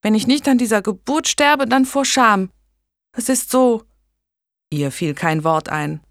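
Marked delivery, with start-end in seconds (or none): 0.0-3.8 high, distressed outburst; gasping. A narrator's reading. Megan in labour, dying of shame, gasping. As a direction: push it out in a raised, mildly panting voice. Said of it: "Megan keuchte".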